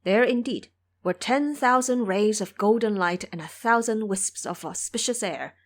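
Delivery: usual speaking voice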